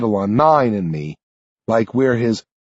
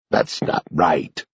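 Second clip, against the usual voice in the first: put-on low, slow, softer old man reproaching; accusing; 8 semitones lower, 4 dB quieter, slower than usual. A narrator's reading. Old man accusing the hero who saved him, low. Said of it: "Sanderson says to me accusingly".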